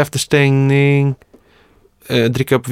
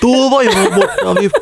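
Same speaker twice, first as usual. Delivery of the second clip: put-on Stockholm accent; 9 semitones higher than usual. Joining the Stockholm impression.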